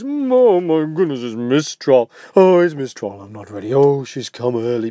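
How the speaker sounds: lightly voiced ogre in a fluster; panicked ogre.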